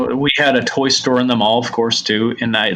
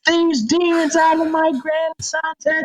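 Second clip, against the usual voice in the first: high, hammed-up preacher casting out demons; mock exorcism. Grandmother exorcising grandson in restaurant, hammed-up preaching. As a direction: rebuke the demon like a tiny old grandma. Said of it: "starts trying to exorcise me".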